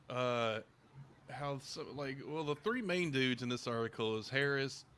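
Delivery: ordinary delivery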